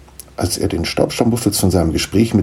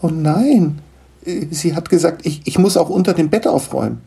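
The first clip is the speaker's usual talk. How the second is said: startled, alarmed; 10 semitones higher than usual. Acted. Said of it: "Er erschrak".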